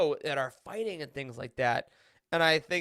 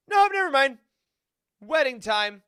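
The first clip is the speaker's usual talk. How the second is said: much higher and louder, breezily dismissive; mock flippant elite; 8 semitones higher, 8 dB louder than usual. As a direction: toss it off like a bored noble brushing grief aside. Mocking the rich leaders' attitude.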